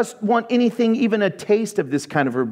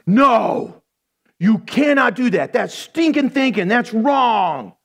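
raised, emphatic scolding; acted indignant telling-off; mock rebuke; 3 semitones higher, slower than usual. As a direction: flatly forbid it, punching each phrase. Acts Peter rebuking Jesus.

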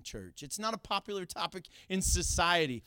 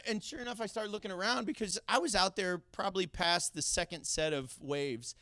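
ordinary delivery

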